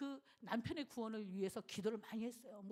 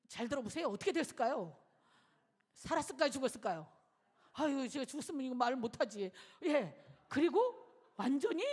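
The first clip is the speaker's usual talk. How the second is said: preaching emphasis; 8 dB louder than usual. Acted. Preacher builds suspense to congregation.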